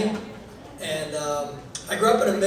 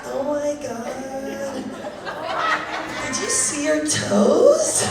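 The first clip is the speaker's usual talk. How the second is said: mock amazed wife; 5 semitones higher, slower than usual. Wife's laughing reply acted.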